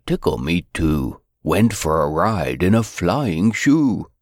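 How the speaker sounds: ordinary delivery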